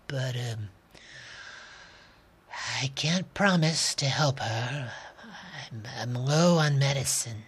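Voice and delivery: soft, weary, hesitant doctor; tired woman voice. Acted. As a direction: let the tiredness weigh every word.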